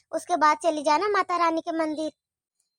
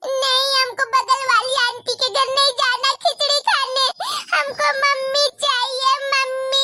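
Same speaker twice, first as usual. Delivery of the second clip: put-on whiny little girl; 5 semitones higher than usual. Younger daughter refuses, crying.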